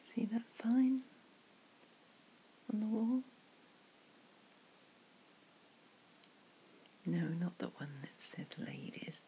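hushed ASMR whisper, close to the mic; soft whisper. Acted. To a friend at the pub, whispered ASMR comfort. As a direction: breathe the words softly like a secret.

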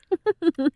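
light, high giggle; amused giggle. Acted. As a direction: giggle in short bursts. Amused by the roommate.

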